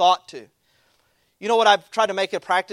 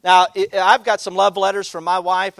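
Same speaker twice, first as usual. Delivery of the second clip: preaching at full force; 10 dB louder than usual. Acted. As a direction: proclaim it to the whole congregation. Preacher opens on Scripture.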